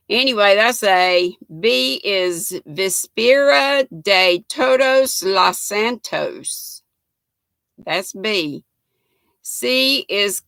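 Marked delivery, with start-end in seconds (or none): none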